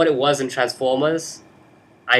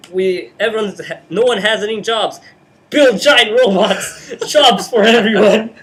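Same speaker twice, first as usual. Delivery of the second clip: excited pitch; 9 semitones higher, 8 dB louder than usual. Retold or imitated. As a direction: build up into a grand excited pitch. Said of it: "They're like".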